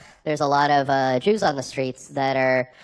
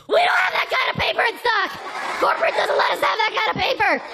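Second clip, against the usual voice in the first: high shrill-free yelling clerk, much higher; outraged shout. Retold or imitated. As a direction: scream it like a panicked clerk. Kinko's clerks yelling at customer.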